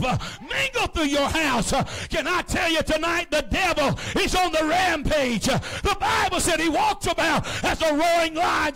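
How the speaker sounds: loud, high, shouting preacher's climax; preaching at full force. Acted. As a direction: preach it out hard, lifting the whole room. Sermon rising to a shout.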